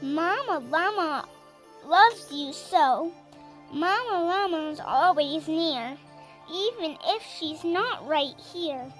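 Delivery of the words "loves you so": plain reading voice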